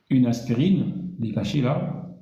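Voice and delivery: ordinary talk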